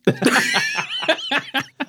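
shrieking burst of laughter; high-pitched laugh. Real, not acted. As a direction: let out a squealing laugh. Big laugh after joke.

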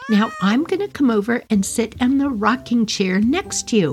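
put-on high, delighted little-girl voice. Four-year-old talks to her cat, high and delighted. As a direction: play yourself at four, thrilled with the game. Child self voiced in story.

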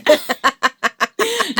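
high, loud burst of laughter; real, uncontained laugh. Real, not acted. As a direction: crack up after your own plea. Laughs after her own plea.

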